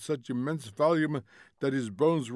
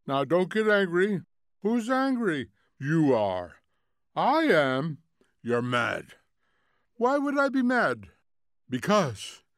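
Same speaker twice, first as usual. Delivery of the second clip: raised, high-pitched squabbling; heated bickering; 5 semitones higher, 5 dB louder than usual. Acted. TV relatives quarrelling over who's angry, loud bickering. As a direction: trade quick indignant retorts, swooping up high.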